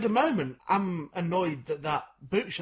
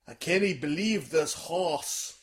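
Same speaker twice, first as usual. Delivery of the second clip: put-on slow, drawled American cowboy accent; much slower than usual. Auditioning for a cartoon blacksmith, slow American cowboy drawl. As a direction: stretch it out like an old Western blacksmith. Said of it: "that's a tick next to American".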